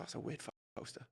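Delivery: breathless whisper of a private thought. Retold or imitated. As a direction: whisper it like an aside to yourself.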